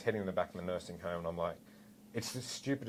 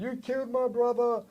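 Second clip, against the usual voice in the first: retold yell; 4 dB louder than usual. Officer to the man who hit his brother, yelling. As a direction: re-enact an anguished scream at an attacker. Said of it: "yelling at him".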